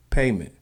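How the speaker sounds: "payment" for pavement, V left out; dropped V. Shown as a demonstration. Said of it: "without the V".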